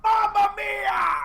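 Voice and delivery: high, drawn-out imitation scream; full-voiced, high-pitched mimicked shout; emphatic scream. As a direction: belt it out like an over-excited announcer. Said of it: "emphatic screams that he does".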